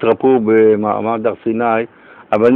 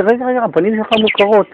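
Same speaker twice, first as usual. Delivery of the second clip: much higher, half-squealed; sudden recognition; 10 semitones higher, faster than usual. Retold or imitated. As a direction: catch yourself mid-realization. Host re-enacts recognizing the clown. (הפתעה בקול גבוה)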